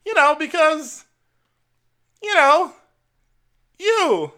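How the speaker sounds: high, fluttery mock elderly lady voice; old lady imitation. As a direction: squeak it out like a sweet old grandma.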